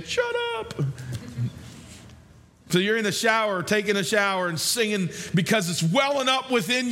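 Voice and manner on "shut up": squeaky, much higher mock "shut up"; mock family. Family telling the shower singer off, squeaky mock. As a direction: squeak it like an annoyed relative. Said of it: "your family's saying".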